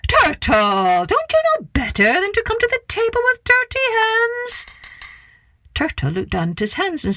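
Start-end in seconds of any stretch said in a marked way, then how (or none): 0.1-4.7 high scolding yell; trickster tale voices. Acted. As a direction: snap at him like a fussy host. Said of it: "Anansi yelled".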